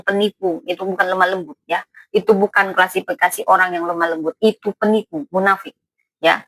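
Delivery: ordinary talk, nothing marked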